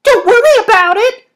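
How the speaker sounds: very high, loud incredulous echo; shrieked incredulous question; disbelief. A narrator's reading. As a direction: demand it back in disbelief, pitch shooting up. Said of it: "I demanded in disbelief".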